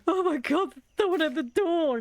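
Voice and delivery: high, frantic mock alarm; panicked dog voice.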